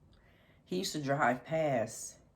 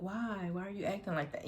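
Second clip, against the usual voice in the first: higher, puzzled mock whine; weird pleading voice; 8 semitones higher than usual. Ex at the door, asking why in a lightly mocked whine. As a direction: mimic his odd pushy question lightly. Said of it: "You know, just weird".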